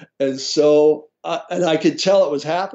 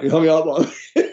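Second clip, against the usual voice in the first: laughing; 3 semitones higher than usual. Real, not acted. Laughing while retelling sister call.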